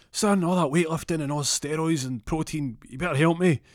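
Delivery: high, put-on dad voice; demanding father. Father calling his son to help lift, high demanding voice. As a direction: order him to help, high and loud.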